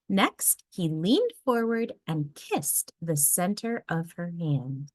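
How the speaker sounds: plain reading voice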